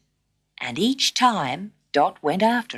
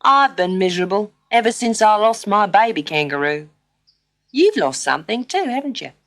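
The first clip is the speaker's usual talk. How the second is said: kangaroo voice; 3 semitones higher, 4 dB louder than usual. A narrator's reading.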